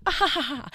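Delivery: high, put-on "ha ha ha"; mock dismissive laugh. Anna laughing off her rude answers, mock laugh. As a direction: imitate someone laughing off a rude remark. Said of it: "and just laugh it off".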